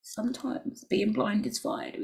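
normal talking voice

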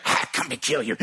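low demonic threat, acted out; deep, menacing put-on voice; possessed man's voice. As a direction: imitate a possessed man mid-choke. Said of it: "a voice of a different man".

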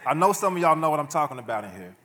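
laughing shout to the congregation; laughing call out. Real, not acted.

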